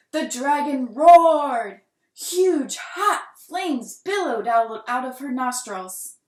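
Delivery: loud, slow, roaring narration; dramatic roar. A narrator's reading. Narrator acting out the dragon's fiery roar, loud and slow.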